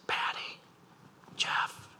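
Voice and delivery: soft whisper of a name in the night; hushed whisper calling a sleeper; God whispering a name. Retold or imitated. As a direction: breathe the name gently, like waking someone. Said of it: "you're gonna hear his voice".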